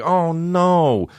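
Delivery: high-pitched fake horror; mock dismay. Viewer reacting to radioactive animals, mock dismay. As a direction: put on an exaggerated worried cry.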